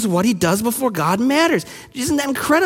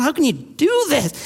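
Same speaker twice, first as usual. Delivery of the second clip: higher-pitched incredulous question; 6 semitones higher than usual. Acted. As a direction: ask it as if you can't believe it. Mock incredulous question in aside.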